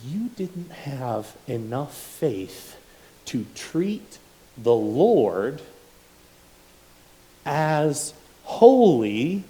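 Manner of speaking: very slow, each phrase drawn out and rising; slow building rebuke. Acted. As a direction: stretch every word, building toward a shout.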